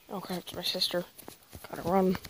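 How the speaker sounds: normal talking voice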